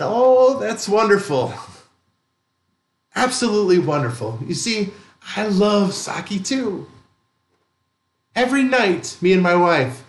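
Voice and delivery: put-on old man beams at the angry drunk, delighted. A narrator's reading. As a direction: answer with pure delight, like sharing a secret.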